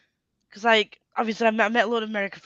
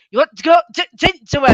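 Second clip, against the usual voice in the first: loud, high Scouse imitation; mock Scouse accent; 5 semitones higher, 11 dB louder than usual. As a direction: take the piss out of a Scouser, loudly. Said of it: "piss out of his accent".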